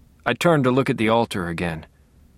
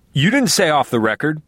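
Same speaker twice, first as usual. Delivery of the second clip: higher, louder blurt; 5 semitones higher, 4 dB louder than usual. A narrator's reading. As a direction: burst out indignantly. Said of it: "Rick Sangre blurted out".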